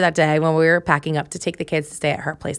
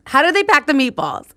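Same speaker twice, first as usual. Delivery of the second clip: higher and louder, with "pack" punched; incredulous exclaim; 8 semitones higher, 4 dB louder than usual. Real, not acted. Asking viewers how the movers packed the meatballs, in loud disbelief. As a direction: repeat it in rising disbelief.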